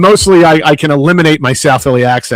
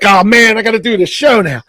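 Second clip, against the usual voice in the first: higher, quicker, half-mock eagerness; excited self-quote; 7 semitones higher than usual. Host recalling his reaction to the show's opening, excited. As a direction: blurt it out like you can't wait to start. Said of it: "I'm like".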